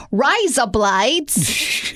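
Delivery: slow, drawn-out Australian "Razor blades"; playful Aussie impression; Australian accent. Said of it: "That's my best Australian accent".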